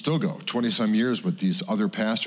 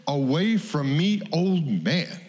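high, slow, exasperated get-away; irritated brush-off; 8 semitones higher, slower than usual. Retold or imitated. Mike wanting to shove off the pastor, slow and exasperated. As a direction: push the old man off, fed up. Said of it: "And I wanna say".

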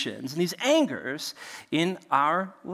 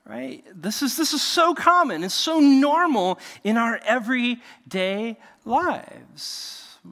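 ordinary talk

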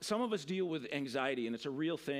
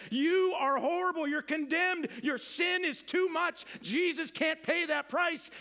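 high, emphatic accusing voice; condemning accuser; 9 semitones higher, 4 dB louder, slower than usual. Acted. Accuser to believer about sin, condemning. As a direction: hurl it as a condemnation, pitched up.

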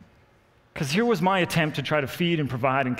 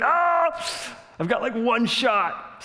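excited hunter; 10 semitones higher, much slower than usual. Retold or imitated. Spotting the running deer.

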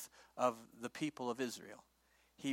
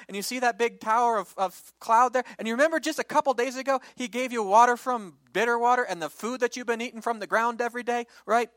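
louder, higher, pressing exasperated rant; exasperated lecture; 6 semitones higher, 7 dB louder than usual. Acted. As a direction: scold them for forgetting everything. Moses lectures the ungrateful people.

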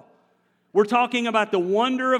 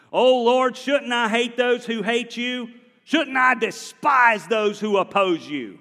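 angry; 3 semitones higher, 5 dB louder than usual. Acted. Preacher reading David's angry psalm aloud, angry. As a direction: rage at God's enemies. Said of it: "I'm reading this angry".

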